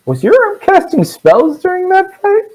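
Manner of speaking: very high, slow, astonished question; incredulous question. Acted. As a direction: ask it slowly in high-pitched amazement. NPC questions the arm's spells.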